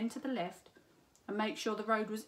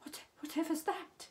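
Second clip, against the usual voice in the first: higher, stammering scared question; frightened cat; 7 semitones higher than usual. A narrator's reading. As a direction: stumble over the words as if spooked. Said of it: "looked very frightened".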